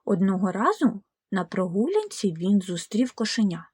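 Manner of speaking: ordinary delivery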